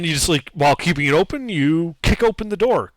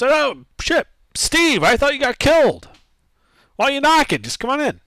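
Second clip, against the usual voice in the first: much higher, louder guard calling through the door; a relieved sentry, pitched well up; guard greets a lost comrade through the door, pitched high; guard behind door; 12 semitones higher, 3 dB louder than usual. Acted.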